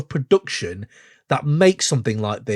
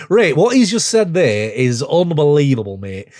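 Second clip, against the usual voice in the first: put-on playful full Yorkshire accent, a bit louder; 3 dB louder than usual. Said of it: "I've gone full Yorkshire".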